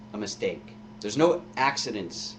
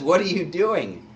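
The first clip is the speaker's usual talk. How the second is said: a louder, higher, firm rebuke; rebuking thief; 6 semitones higher, 6 dB louder than usual. Acted. Thief rebukes the mocking thief, firm and louder. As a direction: scold him, taking charge. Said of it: "He says".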